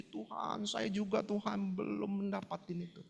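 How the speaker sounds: acted-out quiet crying prayer; tearful prayer. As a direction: murmur a weepy confession to God, retold. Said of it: "saya nangis-nangis".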